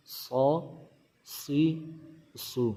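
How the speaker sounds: سو، سی، سو with a heavy, full s; thick sad. Shown as a demonstration. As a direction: read "saw, see, soo" with a darkened s. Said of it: "تو اس کو تھوڑا سا موٹا کر کے پڑھیں گے".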